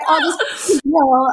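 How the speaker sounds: high, slow, pitying sigh of an officer; mild imitation of an officer. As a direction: slowly pity the silly crying girl. Customs officers pitying the crying girl.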